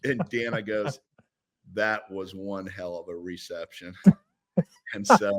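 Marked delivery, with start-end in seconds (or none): 1.7-3.9 much softer, lower deadpan quote; understated wife's comment. Retold or imitated. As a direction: drop the voice for a dry verdict. Wife's dry comment voiced.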